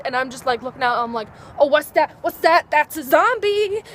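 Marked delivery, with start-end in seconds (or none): 1.6-3.8 louder, rising alarmed cries; imitating a jumpy, paranoid self; mock panic. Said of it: "I am extremely paranoid".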